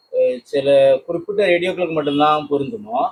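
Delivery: normal talking voice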